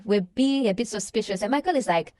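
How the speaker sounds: usual speaking voice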